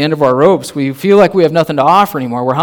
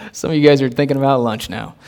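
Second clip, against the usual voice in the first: usual speaking voice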